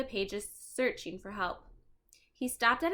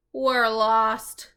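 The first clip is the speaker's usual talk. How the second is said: loud, flat dismay; dismayed together; 10 dB louder than usual. Acted. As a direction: say it in shared alarm. Both realize together.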